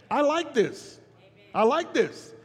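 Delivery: usual speaking voice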